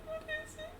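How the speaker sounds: tiny high-pitched question, about to cry; near tears. Acted. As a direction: ask it very softly, close to tears. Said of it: "She's, like, about to cry".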